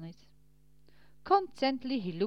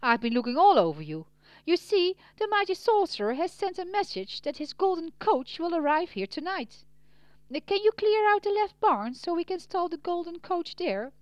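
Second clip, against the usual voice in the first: higher, louder innkeeper voice; busy innkeeper; 9 semitones higher, 7 dB louder than usual. A narrator's reading. Innkeeper tells Peter about the sorcerer's message, high and loud. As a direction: press the news and the request on him, voice raised.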